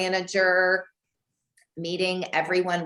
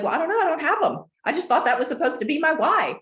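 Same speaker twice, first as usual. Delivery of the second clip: defensive retort; 9 semitones higher, 3 dB louder, faster than usual. Retold or imitated. Quoting her answer to coach.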